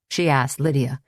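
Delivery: ordinary delivery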